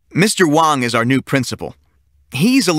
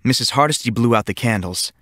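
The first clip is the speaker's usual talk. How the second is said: ordinary delivery, nothing marked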